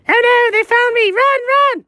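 high, frantic cry to run; panicked escape. Acted. Cat caught in the girls' room, panicked high cry. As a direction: yelp it out like you've just been caught.

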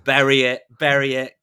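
hammed-up loud command from the studio; mock studio order. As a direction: bark it like a studio exec dumping the film. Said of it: "they're like".

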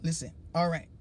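normal talking voice

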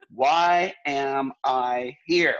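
very slow, each word stretched out; drawn-out bewilderment. Retold or imitated. Bystander eating cookies amid the tears, slow drawn-out bewilderment. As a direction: drag out every word in mock bewilderment.

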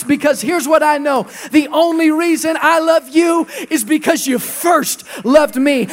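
loud, impassioned sermon climax; full-force preaching. Real, not acted. Preacher crying out to God before the congregation, impassioned.

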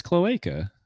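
cloaca as "klo-AY-ka"; slow cloaca with middle syllable "ay"; corrected pronunciation. Shown as a demonstration. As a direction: stress a long AY in cloaca. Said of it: "Is it cloaca or cloaca?".

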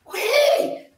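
high shouted güey; loud exclamation. Real, not acted. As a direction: belt out the exclamation. Exclaims loudly at guest.

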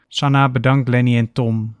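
plain reading voice

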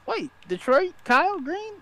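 very high-pitched mock amazement; excited realization. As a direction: replay the thrill of discovery in a squeaky-high register. Acting out own excited discovery.

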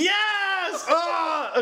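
high, drawn-out excited yell; real delighted outburst; triumphant cheer. Real, not acted. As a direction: celebrate at a high pitch, then cry out. Cheers at finally getting the pun.

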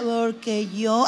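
low, dragging, sedated drawl; mock heavily medicated friend; slowed-down speech. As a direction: imitate someone drugged, stretching the words slowly. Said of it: "habla raro, como rebajada".

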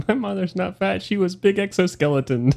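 indignant spider voice. Retold or imitated. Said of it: "That's what he says back".

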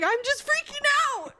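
very high panicked wail; mock screaming. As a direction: squeal it like you've lost control. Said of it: "I'm screaming".

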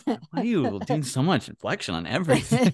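mock listener. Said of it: "people were like".

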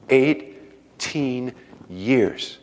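"Eighteen years" drawn out very slowly, each word weighted; stress on eighteen years. Acted. Preacher echoing the wife's letter to the congregation, slow emphatic repeat. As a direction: let "Eighteen years" sink in, slow and heavy. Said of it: "repeats for emphasis".

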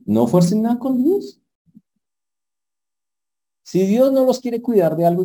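pounded pulpit warning; emphatic preaching. Real, not acted. Preacher stresses his lesson.

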